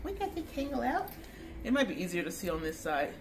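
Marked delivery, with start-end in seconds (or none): 0.0-1.1 pet talk. Real, not acted. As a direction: coo praise to a dog in a high voice. Baby-talking to the dog.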